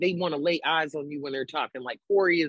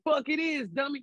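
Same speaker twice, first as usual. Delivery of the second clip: mock aggressive; 8 semitones higher than usual. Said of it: "Y'all gonna be like".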